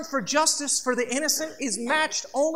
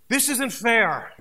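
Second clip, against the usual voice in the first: slow, louder sulky protest; whiny complaint; 5 dB louder, slower than usual. Acted. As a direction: whine it like a sulking teen. Cain complains to the Lord.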